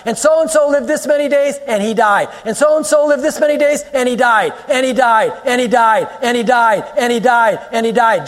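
high, repetitive list with falling refrain; relentless recitation. Acted. Preacher runs through the Genesis list of deaths, relentless recitation. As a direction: hammer each refrain with the same falling tune.

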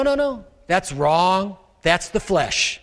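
normal talking voice

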